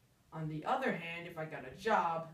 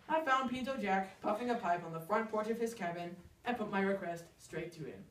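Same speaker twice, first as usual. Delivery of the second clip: ordinary delivery, nothing marked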